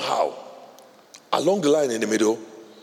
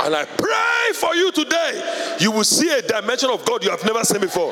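shouted prayer; 11 semitones higher than usual. Acted. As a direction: pray over them at the top of your voice. Prayer at full force.

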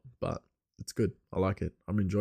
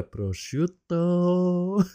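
high, slow Italian prosciutto, nearly laughing; put-on Italian accent; 8 semitones higher, much slower than usual. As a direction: stretch the word in mock Italian. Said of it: "she'd be saying everything normal".

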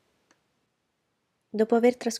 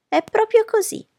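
much higher, loud indignant cry; offended mother exclaiming, much higher and louder; offended exclamation; 11 dB louder than usual. Acted. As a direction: bristle at the insult. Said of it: "esclamò missus Bennet, offesa".

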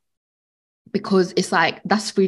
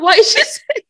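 laughing disbelief; 12 semitones higher, 6 dB louder than usual. Retold or imitated. Mocking a confused listener.

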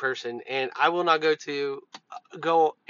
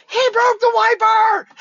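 very high, loud, drawn-out tattletale scream; 10 dB louder than usual. Retold or imitated. Randy jumps up in front of the customer, screaming. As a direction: scream the accusation out for the customer to hear. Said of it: "like, screaming it".

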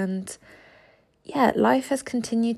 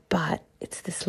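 whisper; 4 dB quieter than usual. Real, not acted. Savouring her free time.

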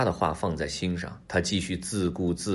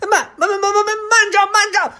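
panicked stuttering shriek, very high; frantic high fox shouting to halt; desperate loud cry; 9 dB louder than usual. A narrator's reading. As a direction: stammer and yell for your life. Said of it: "情急之下大声地喝道".

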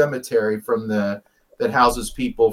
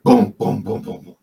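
imitated thumps; 4 semitones lower than usual. Retelling more thumps heard from inside the car, imitated booms. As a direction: run of booms dying away. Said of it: "it was something heavy".

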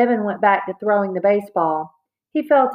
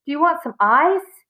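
plain reading voice

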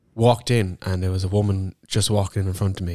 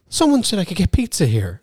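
high, puzzled imitation of the woman; confused customer voice; 11 semitones higher than usual. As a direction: play a baffled customer, pitched up.

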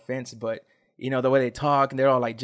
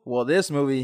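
usual speaking voice

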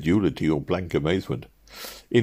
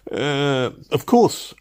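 high-pitched, anticipating the objection; hasty interjection; 9 semitones higher, 3 dB louder, much slower than usual. A narrator's reading. Traveller forestalling the Chief Office man, hasty. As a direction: cut in quickly on a raised pitch. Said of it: "I hastily anticipated".

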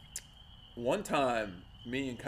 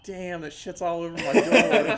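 laughing complaint; 4 semitones higher, 6 dB louder than usual. Retold or imitated. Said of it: "I'm like".

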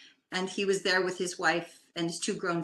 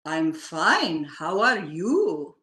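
slow, louder, booming holy-man voice; 5 dB louder, much slower than usual. Retold or imitated. Older gentleman at the spa greets her, slow and booming. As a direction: boom it out slowly like a revered religious figure. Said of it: "booming voice".

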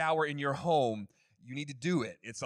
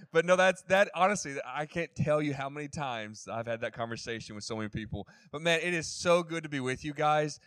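usual speaking voice

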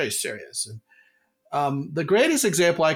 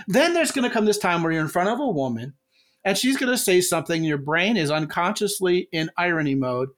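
normal talking voice

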